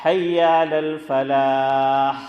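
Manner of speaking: slow chanted phrase ending in a strong ح on "الفلاح"; big ha sounded. Shown as a demonstration. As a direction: stretch "الفلاح" and release a throaty h at the end. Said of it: "تو یہ ہے".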